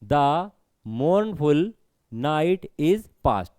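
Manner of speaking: "The mournful night is past" very slowly, word by word; reading for meter. Shown as a demonstration. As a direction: stretch each beat of "The mournful night is past". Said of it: "ऐसे ही पढ़ना है इसको".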